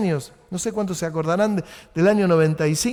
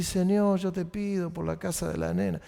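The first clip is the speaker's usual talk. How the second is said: hushed, much softer prayer; quiet prayer; 8 dB quieter than usual. Retold or imitated. Father praying for the girl's house, hushed. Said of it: "Esta chiquita se lo decía al padre".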